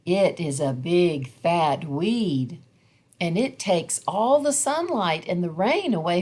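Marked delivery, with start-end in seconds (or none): none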